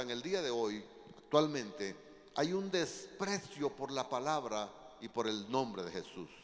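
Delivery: usual speaking voice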